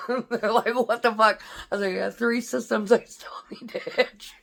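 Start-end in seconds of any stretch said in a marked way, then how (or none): 0.7-1.4 raised, loud question through laughter; hysterical laughing. Retold or imitated. Bunker mates reacting to the shaken soldier, laughing. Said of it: "like, hysterically". 1.9-4.3 high, airy, voice gone with laughter; breathless laughing. Retold or imitated. Shaken soldier explaining to bunker mates, laughing breathlessly. Quoted answer told through helpless laughter.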